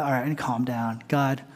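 a hushed plea to God; calming himself down. Retold or imitated. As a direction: talk yourself down quietly. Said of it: "I'll calm down".

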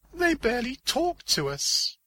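high-pitched female character; high voice for the heroine's reply; Diana. Acted. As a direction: answer in a high put-on voice.

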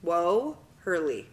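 "Woherly" slowly, split into wo and herly; "Woherly" drawn out, wo... herly; teachers' pronunciation. Shown as a demonstration. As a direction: sound out "Woherly" slowly for a guesser. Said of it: "how all my teachers pronounced it".

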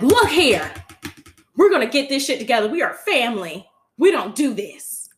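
loud, high mock family matriarch scolding; Mama Tina lecture. Imitating Mama Tina scolding her family, loud and high.

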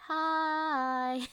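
high, eerie ghostly hai. Retold or imitated. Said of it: "Or, like".

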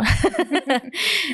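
light affectionate giggle. Real, not acted. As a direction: chuckle fondly. Laughs about her mother-in-law.

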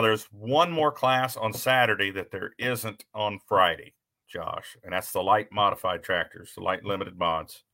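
ordinary talk

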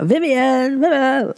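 high, imitated posh British speech defect; put-on comic British lisp; mocking posh lisp. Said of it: "they used to make fun of that".

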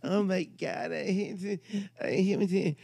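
high, slow mock fan voice; mock gushing woman. Teller mimicking annoying woman at the bar. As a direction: ham up a gushing groupie. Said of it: "She's like".

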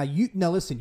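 ordinary delivery

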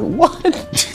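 high, quiet, puzzled yelp; mock a baffled old patient; hard-of-hearing old man. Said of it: "really old and hard of hearing".

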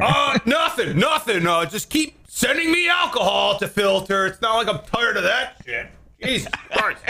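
high, startled shout of nothing; nervous organ voice. Acted. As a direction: caught out, then grumbling sarcastically. Organ answering the brain.

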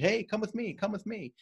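high, bright call leading from the front; rally them up from the front, pitched well up; calling out to follow. Retold or imitated. Leader at the front calling the team along, high eager call.

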